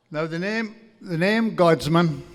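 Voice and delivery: ordinary talk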